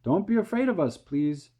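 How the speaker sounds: high, loud plea; calling out. A narrator's reading. James calls down to the frightened men, high and loud. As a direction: call down reassuringly to the men. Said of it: "James called out".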